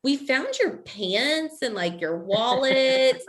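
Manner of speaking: slow, drawn-out awkward report; hesitant retold call. Facilities caller reporting found pants, slow hesitant delivery. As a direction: stretch each phrase, pitched slightly up.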